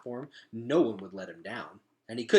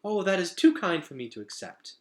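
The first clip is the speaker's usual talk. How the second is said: a touch of flustered, higher; embarrassed; 7 semitones higher, 6 dB louder than usual. A narrator's reading. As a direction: bashfully brush off the praise. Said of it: "very embarrassed".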